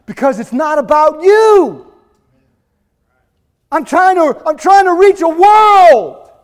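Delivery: high, loud acted answer from God; emphatic rebuke. Voices God's emphatic answer to pastor.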